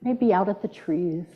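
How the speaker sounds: hushed, gentle prompt; quiet coaching voice; soft guiding cue. Shown as a demonstration. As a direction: say it softly, inviting the room to look up. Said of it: "practice again a little bit".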